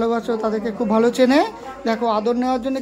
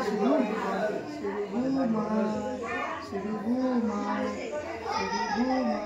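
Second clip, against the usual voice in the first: hushed, gentle sing-song telling the dog to sleep; soothing lull to sleep; 13 dB quieter than usual. Real, not acted. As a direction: coax a pet to doze off softly. Lulling the dog Sheru to sleep.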